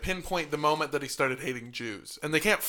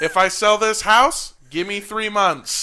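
TV ad pitchman; 4 semitones higher, 8 dB louder than usual. Pretend. Continuing the ad voice.

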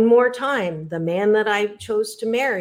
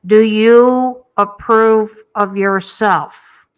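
very slow, much louder, solemn questions; the inner voice; 7 dB louder, much slower than usual. Retold or imitated. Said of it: "the voice said".

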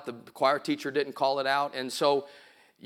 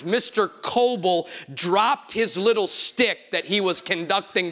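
high, loud, emphatic sermon delivery; big, raised pulpit voice; full-force preaching; 10 semitones higher, 8 dB louder, slower than usual. Real, not acted. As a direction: preach it out with long stressed words.

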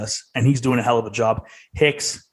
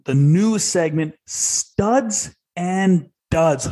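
announcer; 5 semitones higher, much slower than usual. Acted. Host announces segment title.

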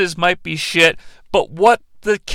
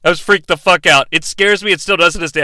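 ordinary talk

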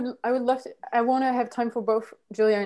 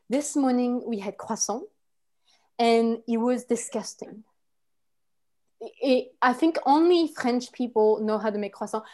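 put-on hesitant French accent. French person discusses croissants, in a French accent. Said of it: "Ah, the French accent".

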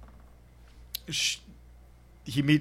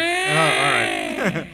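drawn-out mock resignation; 3 semitones higher, 6 dB louder, much slower than usual. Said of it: "he's like".

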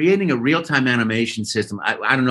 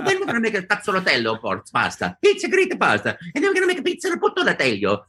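high, giddy cartoon Italian voice; Mario; 8 semitones higher, faster than usual. Actor inventing pasta to keep auditioning, high playful Italian voice. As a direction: relish made-up pasta names in a bouncy Italian accent. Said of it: "I started making up pasta".

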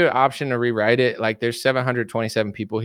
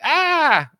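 sudden high yelp of alarm; short high cry of panic; mock scream; 4 dB louder than usual. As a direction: yelp like you just got shot. You reacting to being shot.

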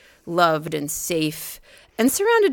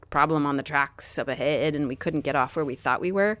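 usual speaking voice

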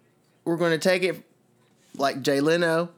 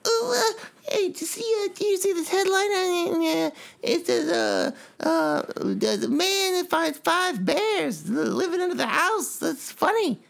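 much higher, hammed-up talk-show host voice; Jay Leno impression; 13 semitones higher than usual. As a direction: play the chuckling late-night host reading a headline. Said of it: "This is Jay Leno".

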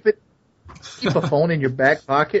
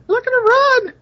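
much higher, squealing it out; squeaky high shout; 3 dB louder, slower than usual. Acted. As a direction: squeal it like a cartoon. Cheering on a runner.

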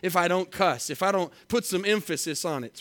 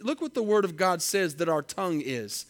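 ordinary delivery

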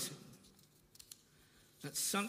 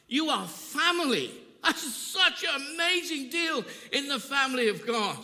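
slow, much louder, climbing higher; preaching at full force; 6 semitones higher, 10 dB louder, slower than usual. Acted. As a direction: proclaim it to the congregation, word by word. Preaching climax, rising intensity.

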